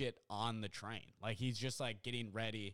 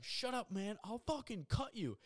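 high-pitched mock threatening voice; imitated agitated threat; 10 semitones higher than usual. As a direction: play a worked-up dude in a much higher voice. Drug user threatens in quarrel.

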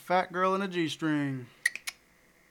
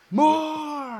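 loud demand for more; shouting; 10 semitones higher, 5 dB louder than usual. Real, not acted. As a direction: call out for more. Calling for more questions.

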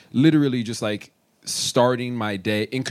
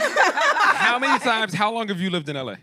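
mock incredulous; 11 semitones higher than usual. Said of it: "exaggerated mock question".

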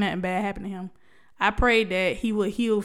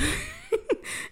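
a burst of high-pitched laughter; 6 dB quieter than usual. Real, not acted. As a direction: laugh it off at yourself. Laughs at herself.

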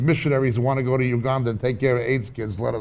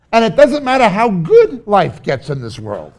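emphatic preaching; 8 semitones higher, 8 dB louder than usual. Real, not acted. Preacher building up contrast.

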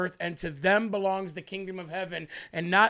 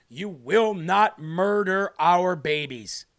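slow, louder, every word hammered; slow forceful declaration; 4 dB louder, slower than usual. Acted. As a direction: lay down the law slowly.

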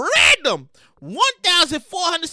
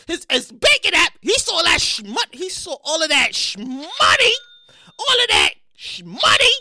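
ordinary talk

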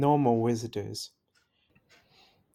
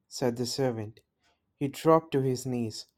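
plain reading voice

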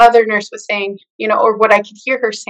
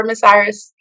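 ordinary talk, nothing marked

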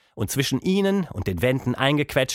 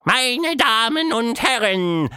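high, slow, louder ringmaster cry; loud showman call; 12 semitones higher, 4 dB louder, slower than usual. A narrator's reading. Quatschmonster calling to the mouse, loud showman announcement. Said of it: "rief Quatschmonster laut".

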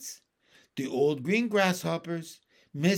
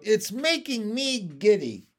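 raised, pleading voice; calling out; 5 semitones higher, 3 dB louder than usual. A narrator's reading. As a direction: call out in giddy distress. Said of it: "Miss Spider called out".

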